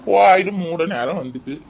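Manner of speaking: put-on slow, drawn-out elderly grumble; grumbling old man. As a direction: nag your wife like a weary old husband. Plays grumbling old husband.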